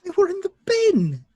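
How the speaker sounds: high, loud shocked protest; squeaky indignant yelp; indignant outburst. Acted. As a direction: blurt it out, outraged. Sean indignant about cigarettes.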